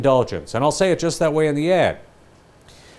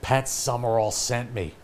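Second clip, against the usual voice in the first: quiet, slow, embarrassed mumble; sheepish customer; 5 semitones lower, 9 dB quieter, slower than usual. Retold or imitated. Customer mumbling the ad slogan at the counter, quiet. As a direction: say it like you feel silly. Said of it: "I'm not gonna walk up and go".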